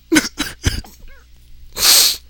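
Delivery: high, breathless squeal of laughter; helpless silent wheeze; wheezing laugh. Real, not acted. Reader breaks down laughing at the fanfic, wheezing.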